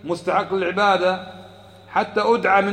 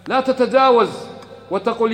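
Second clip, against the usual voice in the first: ordinary delivery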